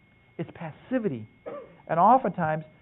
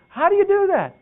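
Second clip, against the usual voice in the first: high, loud, amazed mom voice; mom's amazed question; 9 dB louder than usual. Retold or imitated. Mother marveling at how dad controls the boys, high and loud. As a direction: squeal it like an astonished mother.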